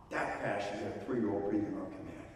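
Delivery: ordinary delivery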